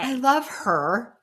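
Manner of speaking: high, slow gushing; drawn out with delight. Real, not acted. Gushing about cast member.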